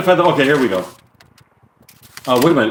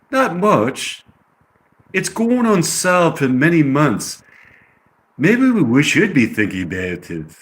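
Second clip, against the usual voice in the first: put-on English accent, higher at first; 4 semitones higher than usual. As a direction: act it in amateur British. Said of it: "It's a pretty good English accent".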